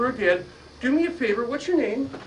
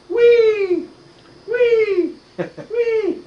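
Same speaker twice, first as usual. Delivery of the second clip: very high, loud playful squeals; squealing block; 12 semitones higher, 9 dB louder, much slower than usual. Pretend. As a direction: squeal like a toy on a swing. Voicing the block swinging.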